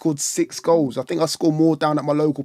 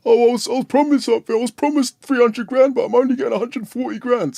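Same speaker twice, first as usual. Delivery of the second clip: high, louder mocking whine of a spoilt player; mock whiny player; 8 semitones higher, 3 dB louder than usual. Mocking the striker complaining about his wages.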